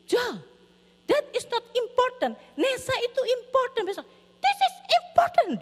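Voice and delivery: high-pitched retold parental telling-off; exasperated scolding. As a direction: scold a child, pitch climbing as it builds. Parent scolding noisy child, retold.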